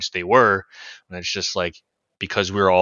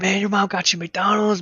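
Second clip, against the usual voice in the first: put-on impressed kid voice; 10 semitones higher than usual. Classmate quote voiced.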